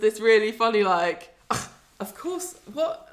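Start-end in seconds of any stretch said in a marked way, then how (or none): 1.5-3.1 huffy, offended disbelief, pitched up. Retold or imitated. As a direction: act out being really affronted. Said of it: "Like, really offended".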